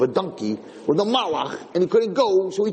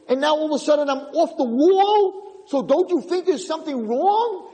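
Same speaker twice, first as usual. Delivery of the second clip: rising higher, building to a mild mock yell; pleading donkey; 7 semitones higher than usual. Donkey protesting to its master, higher and building. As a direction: protest like a loyal child wronged. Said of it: "The Chamor is screaming".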